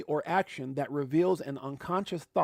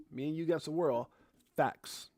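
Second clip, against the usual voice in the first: ordinary talk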